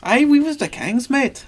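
high, hammed-up Irish accent. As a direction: ham up a bad Irish brogue. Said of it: "I can't do an Irish accent very well".

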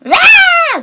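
high, loud screeching roar; pterodactyl shriek. Retold or imitated. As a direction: screech like a flying monster. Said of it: "that's what the Volcra sound like".

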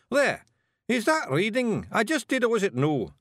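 demanding. Acted. Rob to the Feagles, proudly demanding approval. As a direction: challenge them to deny it. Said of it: "and demanded".

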